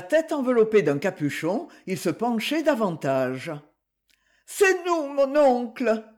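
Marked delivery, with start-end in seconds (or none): 4.5-6.0 high, raised call repeated to the window; calling up again. Acted. Young couple repeats their call up to the uncle, high and raised. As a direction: call up once more, pitched high.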